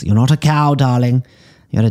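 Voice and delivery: high, loud mock reprimand; mock scolding. Psychic chiding a duckling eating grass, loud mock scold.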